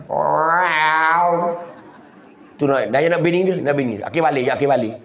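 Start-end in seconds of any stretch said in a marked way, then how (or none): 0.1-1.5 long, drawn-out cat yowl; drawn-out meow. Retold or imitated. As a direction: imitate a yowling cat. Imitates the cat crying out.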